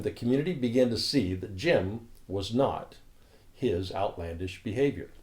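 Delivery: ordinary talk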